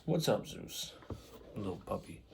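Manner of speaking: soft, quiet cooing at a puppy; talking to the dog. Real, not acted. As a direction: coo at the dog. Greeting the dog mid-video.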